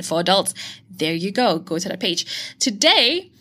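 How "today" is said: ordinary talk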